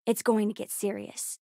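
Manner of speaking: ordinary talk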